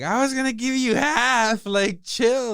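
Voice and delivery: high, loud, indignant plea; protesting yell. Acted. Teddy pleading with the man he was using, high loud protest. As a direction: shout it way up high in mock protest.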